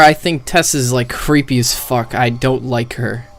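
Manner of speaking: ordinary talk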